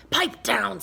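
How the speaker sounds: loud hissing whisper; harsh hissed order. Acted. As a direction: hiss it at the class. Swamp hushing the class.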